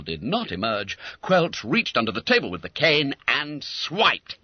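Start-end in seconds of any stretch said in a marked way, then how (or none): none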